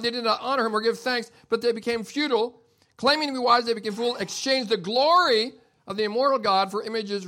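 loud, high, driving sermon delivery; preaching at full force. Acted. Pastor thundering Romans one at his congregation, loud and high.

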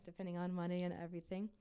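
normal talking voice

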